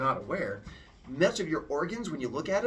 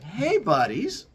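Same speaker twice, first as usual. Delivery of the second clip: slow, higher, louder buddy greeting; friendly Prometheus; 6 semitones higher, 8 dB louder, slower than usual. Acted. Prometheus approaching the humans, slow cheery greeting. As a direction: greet them like a cheerful big brother. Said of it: "He's like".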